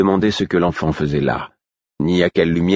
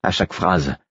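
ordinary delivery, nothing marked

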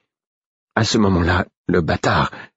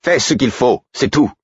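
higher, sharp exasperated order; 7 semitones higher than usual. A narrator's reading. The king, fed up, orders Guillot to get on with it. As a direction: cut him off, fed up. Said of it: "d'un ton exaspéré".

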